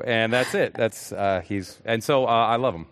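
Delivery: ordinary talk, nothing marked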